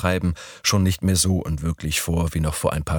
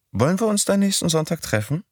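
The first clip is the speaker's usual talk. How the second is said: put-on higher young woman's voice; Midori's voice; 12 semitones higher, faster than usual. A narrator's reading. Narrator voicing Midori proposing Sunday, higher voice. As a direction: pitch up for the girl.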